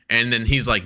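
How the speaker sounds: usual speaking voice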